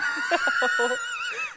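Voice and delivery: high whinnying laugh; horse laugh. Real, not acted. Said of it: "What was that laugh? That sounded like a horse".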